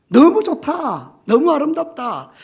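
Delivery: high, loud admiration; delighted exclamation. Acted. Preacher as God looking at the finished world, exclaiming in delight. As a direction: exclaim it with open wonder.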